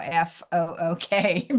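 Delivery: slow, spelled F O O K. Shown as a demonstration. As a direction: slowly spell out F O O K letter by letter. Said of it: "how I spell the word now".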